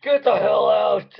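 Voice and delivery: comic guard roaring her away; mock yelling guard. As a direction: bellow at her to clear off, over the top. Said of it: "just with a far end of spear".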